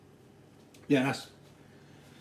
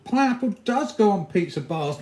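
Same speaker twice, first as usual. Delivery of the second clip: teasing; 7 semitones higher, 5 dB louder than usual. Real, not acted. Teasing pizza argument with chat.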